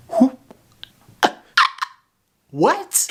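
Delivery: high shocked squeak; sudden disbelieving yelp. Real, not acted. Shocked at permanent numbness.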